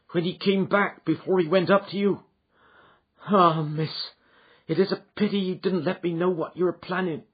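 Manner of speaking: a hint of regret as she explains; lightly voiced maid, regretful; servant woman. Mrs Toller speaks.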